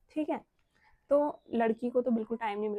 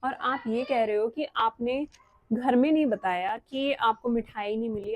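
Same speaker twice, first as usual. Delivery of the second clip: ordinary delivery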